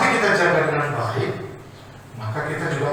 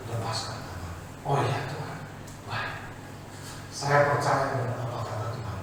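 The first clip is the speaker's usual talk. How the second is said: meek soft reply; 6 semitones lower, 10 dB quieter than usual. Acted.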